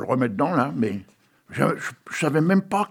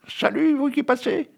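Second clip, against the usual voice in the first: very high crowing rooster voice; 11 semitones higher than usual. Shown as a demonstration. Host trying out the rooster's greeting, very high rooster voice. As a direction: squeak it out high like a crowing cock. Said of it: "il fait pas comme ça".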